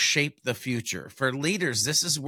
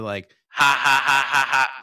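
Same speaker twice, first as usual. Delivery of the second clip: stiff mechanical robot ha-ha, louder; robot laugh, one flat syllable at a time; 7 dB louder than usual. Shown as a demonstration. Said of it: "like, a robot voice".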